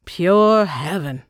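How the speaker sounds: slow, savoring sigh of bliss; lightly moaned with pleasure; pleasured moan. A narrator's reading. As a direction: draw it out, savoring a first sip. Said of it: "moaned after she took a sip".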